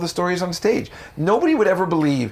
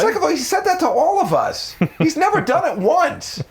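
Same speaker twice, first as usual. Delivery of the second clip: much higher, laughing mock outrage; incredulous mocking rant; 14 semitones higher than usual. Coworker mocking him to the office girls, high and laughing. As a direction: squeal it, amazed and scornful. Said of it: "And I'm like".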